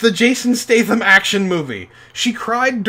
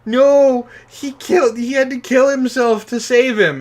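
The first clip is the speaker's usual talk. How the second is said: put-on much higher and louder, an upset kid insisting; upset kid protest; 7 semitones higher, 5 dB louder than usual. Boy defending the Terminator to his parents, upset protest. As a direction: protest like a hurt thirteen-year-old. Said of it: "I was like".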